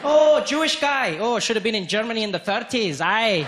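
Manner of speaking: put-on high, slow Scottish accent; a sick host inviting you back; mock Scottish.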